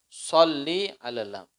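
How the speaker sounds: ordinary talk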